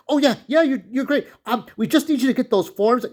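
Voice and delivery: high, bubbly, over-eager greeting; a chirpy imitation of Gerard; cheery guy imitation. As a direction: mimic a grinning people-pleaser. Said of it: "he's that cheery, cheery guy".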